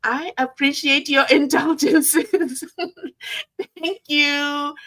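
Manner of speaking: giggling through the thanks; bashful laugh breaking the words. Real, not acted. Vlogger thanks her viewers, blushing, laughing.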